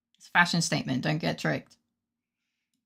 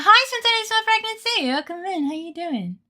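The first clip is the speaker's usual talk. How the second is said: much higher put-on greeter voice; chirpy greeter; 11 semitones higher than usual. Greeting a newcomer in a high put-on host voice. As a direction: sing out the welcome like an over-eager host.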